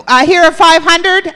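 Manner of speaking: lightly voiced auctioneer calling bids. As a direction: call it out like a lightly played auctioneer. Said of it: "I sound like an auctioneer now".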